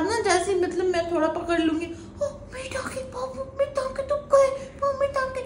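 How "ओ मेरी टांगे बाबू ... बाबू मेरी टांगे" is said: high, wailing fake sob; fake crying. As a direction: whine like you're in pain. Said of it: "तो मतलब मैं नाटक करती हूँ".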